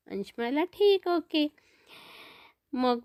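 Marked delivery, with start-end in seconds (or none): 0.6-1.5 put-on high, bright child's okay; little boy. Boy answering his mother before bed, higher child voice. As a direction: agree like a small boy.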